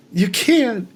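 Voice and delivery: high, whiny mock pleading; a mocking wife impression, high and pleading. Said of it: "she's doing the whole".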